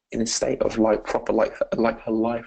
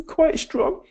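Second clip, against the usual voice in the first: put-on little girl voice. Voicing the girl answering.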